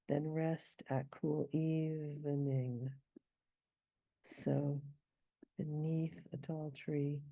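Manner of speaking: slow poetry reading; poem recital. Shown as a demonstration. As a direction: read the lines slowly with the beat. Reciting lines slowly.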